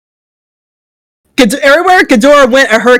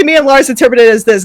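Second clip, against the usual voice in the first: usual speaking voice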